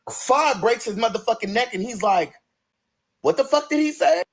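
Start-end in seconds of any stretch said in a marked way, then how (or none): 3.2-4.2 higher-pitched, affronted question; mock indignant boyfriend; indignant disbelief.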